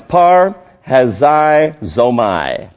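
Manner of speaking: parhazizomai very slowly, in separated pieces; careful pronunciation. Shown as a demonstration. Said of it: "kinda difficult to pronounce".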